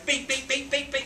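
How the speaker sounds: high, chirpy imitated beeping; pager beeps. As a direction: mimic a pager going off, high and bright. Pager going off again.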